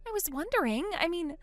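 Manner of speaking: high, urgent pleading voice; a panicked sister begging down the phone; frightened younger sister. Acted. Molly voiced by same speaker.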